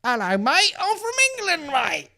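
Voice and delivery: very high cartoon English accent; mock English accent. Comedian exposing rapper's hidden accent, squeaky mock English. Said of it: "trying to hide that accent".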